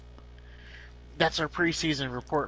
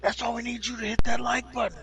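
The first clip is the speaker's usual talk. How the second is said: YouTuber voice; 8 semitones higher, 4 dB louder, faster than usual. Pretend. YouTuber outro parody.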